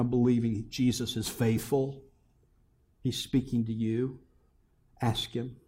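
normal talking voice

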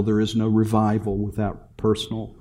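usual speaking voice

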